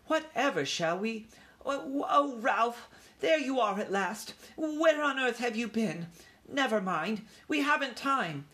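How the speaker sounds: high, flustered mother mouse; worked-up mother in a dither; agitated mother. A narrator's reading. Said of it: "Ralph's mother was so agitated".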